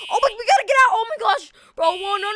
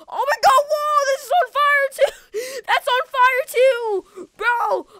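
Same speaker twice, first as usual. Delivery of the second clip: high, loud, stretched-out cry of alarm; hammed-up panic, drawing the words out; alarmed yell; 5 semitones higher, 4 dB louder, slower than usual. Player sees this is on fire too, panicked yelling. Discovers another fire in game.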